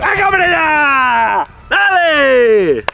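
drawn-out exasperated yell; frustrated shout. Real, not acted. Urging on, frustrated.

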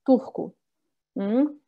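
ordinary talk, nothing marked

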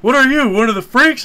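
high, loud jibe; mock outraged challenge. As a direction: throw a loud, hammed-up insult. Retold Helmet insults bearded lady.

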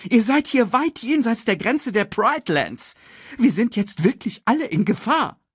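high, urgent character voice; alarmed, bossy Sasu. Acted. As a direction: warn the cubs in a high, insistent voice. Sasu warns the cubs.

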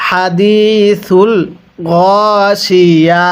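"আতাকা" drawn out very slowly for repeating; slow recitation model. Shown as a demonstration. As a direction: model each word slowly for the class to echo. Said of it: "এরপরে". (ধীরে টেনে শিখিয়ে পড়া)

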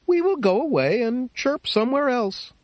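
a hint of bright little crickets; crickets' chorus voice. Crickets' voice held.

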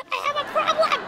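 high, melodramatic wail; wailed confession. Acted. Confessing junk food to her friend, wailing.

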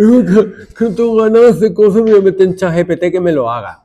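high, loud put-on voice of a lazy kid; mock whiny student. Teacher mocking his students' excuse for using ChatGPT, high whiny voice. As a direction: whine the excuse like a teenager who can't be bothered.

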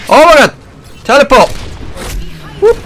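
high, loud outburst at the game; excited yell. Real, not acted. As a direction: shout it out in frantic gamer excitement. Excited shouting during live gameplay.

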